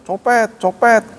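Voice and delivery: high, drawn-out cry of alarm without real volume; mock yell. Bystanders crying pickpocket after the mosque, mock yelling. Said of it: "ada orang teriak-teriak".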